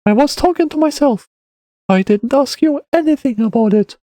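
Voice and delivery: put-on high-pitched boy protesting; defensive child. A narrator's reading. Boy defends himself to the man, high child voice. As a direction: protest innocence in a high kid voice. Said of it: "said in his defense".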